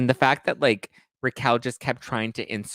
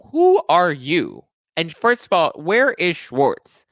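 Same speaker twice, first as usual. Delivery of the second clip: loud, high, very drawn-out demand; indignant confrontation; 6 semitones higher, 8 dB louder, slower than usual. Acted. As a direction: call him out, stretching the words in outrage. Recapper mock-confronts Sandoval.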